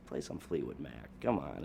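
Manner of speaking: soft, low conspiratorial cue; low aside. Retold or imitated. Bandleader to the guys as the president walks up, low aside. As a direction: drop pitch and volume for the bandmates.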